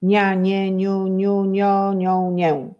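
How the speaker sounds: syllables rattled off quickly in one run; fast reading. Shown as a demonstration. Teacher showing the class speed-reading of syllables, fast and connected. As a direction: race through the syllables without pausing. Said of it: "teraz będziemy czytać szybko".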